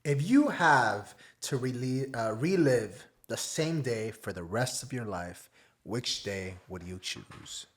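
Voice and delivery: low, slow, hushed delivery; hushed, weighty host question; solemn question. Acted. Host poses the big question.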